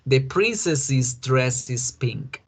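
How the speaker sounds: princess's said as \"prinsesis\", slow; slow model English. Shown as a demonstration. Teacher repeating the example for students. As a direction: say \"princess's\" slowly, ending \"is\". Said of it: "no le pusieron más S".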